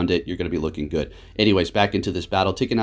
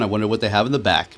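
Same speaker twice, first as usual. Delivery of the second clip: ordinary delivery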